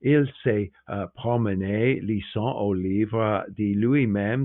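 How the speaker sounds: put-on French spoken with a French accent; French quote. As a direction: quote the French line, savouring it. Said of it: "He says".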